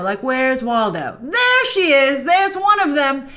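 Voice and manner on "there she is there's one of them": a high, loud burst of delight at the find; excited spotting. Real, not acted. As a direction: call it out like you just spotted Waldo.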